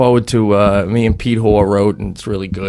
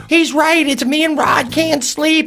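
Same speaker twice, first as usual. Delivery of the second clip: very high, squawking woman impression; piercing falsetto scolding; imitated Paula. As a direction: mimic a neighbor woman calling down. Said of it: "She's like".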